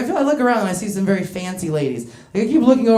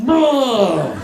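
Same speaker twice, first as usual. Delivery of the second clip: put-on old man grunt; 3 semitones higher, 4 dB louder than usual. Jowly older gentleman grunts.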